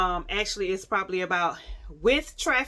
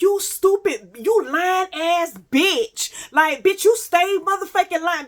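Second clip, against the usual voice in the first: high, loud cussing-out, retold with force; going in; 9 semitones higher, 7 dB louder, slower than usual. Storyteller replays cussing out the man who lied, going in. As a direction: tear into the liar, stretching the insult. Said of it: "Bitch, I'm going in".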